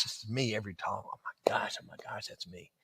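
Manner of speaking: soft whisper to himself; whispered realization. Retold or imitated. Listening to the stories, whispering that it's him. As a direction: breathe it out as a private confession.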